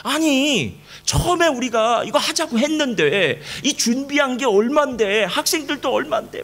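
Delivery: a hint of angry disbelief, slowed down; exasperated outburst. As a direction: vent like a fed-up pastor, laughing through it. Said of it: "화가 난 거죠".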